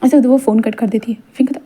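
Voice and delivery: plain reading voice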